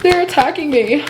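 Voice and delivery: high, put-on voice playing the victim; mocking whiny victim. Mocking the gossiper who claims to be attacked, high whiny voice. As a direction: mimic someone crying that they're under attack. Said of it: "you're like".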